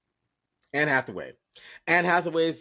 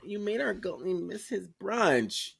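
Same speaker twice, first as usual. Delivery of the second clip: mock outrage; 9 semitones higher than usual. Mock-indignant accusation of S1.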